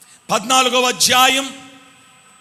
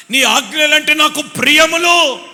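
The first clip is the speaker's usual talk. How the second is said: louder, higher repeat; louder, higher psalmist cry; rising fervor; 6 semitones higher, 8 dB louder than usual. Acted. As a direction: lift the same line up with passion. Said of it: "అని అంటున్నాడు".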